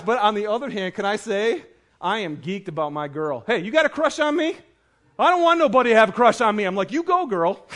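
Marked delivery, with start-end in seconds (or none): 3.5-6.6 lightly imitated feisty kid, higher and louder; sassy little girl. Daughter confronting a boy about a crush, feisty. 6.9-7.6 higher-pitched mock cheer; proud cheer. Dad applauding his daughter's refusal, playfully proud.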